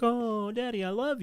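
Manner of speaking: high, sweet mock child voice; dad mocking his kids' sweet-talk in a high child voice; sugary kid voice. Dad mimics kids sucking up.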